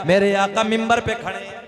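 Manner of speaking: loud sung-out sermon refrain; preaching at full force. Acted.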